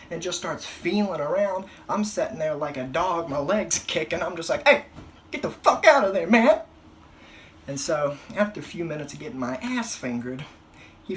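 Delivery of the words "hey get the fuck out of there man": high, loud mock shout of protest; patient yelling mid-exam; panicked yell. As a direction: yell at the doctor to get out. Kicking during prostate exam.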